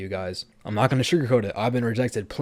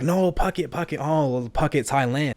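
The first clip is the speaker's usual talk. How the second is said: higher mock voice correcting him, Phuket stretched; imitating the Thai girl; 6 semitones higher than usual. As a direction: play her insisting on Phuket. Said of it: "She's like".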